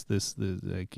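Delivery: usual speaking voice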